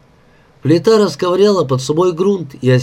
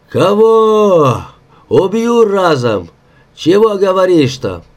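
raised, drawn-out angry roar; frightening bluster; 6 semitones higher, 4 dB louder, slower than usual. A narrator's reading. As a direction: bluster threats at the crew, pitched high. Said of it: "звучал оттого Егорыч страшно". (грозный крик с надрывом)

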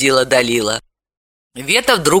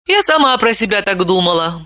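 usual speaking voice

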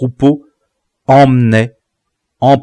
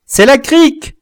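high glad cry; boy hearing a comrade's signal; excited recognition; 5 dB louder than usual. Acted. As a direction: spot your friend with relief.

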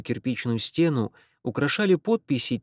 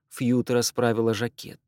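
ordinary delivery

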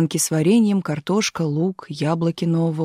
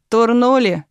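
gleeful question; 5 semitones higher, 4 dB louder, slower than usual. A narrator's reading. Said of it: "радостно спросил он".

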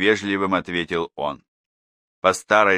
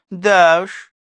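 wry agreement; 11 semitones higher, 8 dB louder than usual. A narrator's reading. Neighbour agrees with Danilov.

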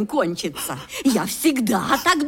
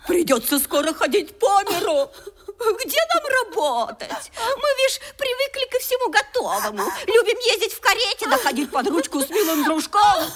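higher, sneering mock-genteel voice; 10 semitones higher, faster than usual. Stepmother mocks the girl's lady airs, sneering. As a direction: ridicule her with laughing scorn.

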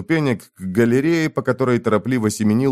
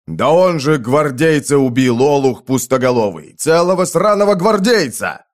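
loud, higher furious rant; lamenting rage; 4 semitones higher, 4 dB louder than usual. Acted. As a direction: rant in outrage at the fool. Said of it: "сокрушался вояка". (возмущённо)

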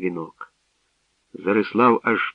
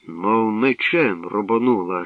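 ordinary delivery

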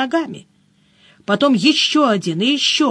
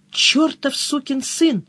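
slow, higher, narrated angry bark; barked curse; 4 semitones higher, slower than usual. A narrator's reading. Cousin curses the relative who beat them to the treasure, barking. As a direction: curse him with drawn-out indignation. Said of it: "рявкнула Мышильда".

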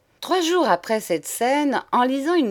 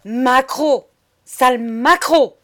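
loud, high, harsh insult; hoarse, horrible yell; 4 semitones higher, 8 dB louder, slower than usual. A narrator's reading. Madame screaming down the corridor, hoarse rage. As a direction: yell it raucously after him. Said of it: "d'une voix devenue encore plus rauque, horrible".

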